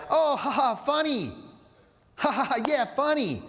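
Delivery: high-pitched imitated girls' polite laughter; amused, into it. Girls reacting to a man singing at them, high and amused. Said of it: "they're, they're into it".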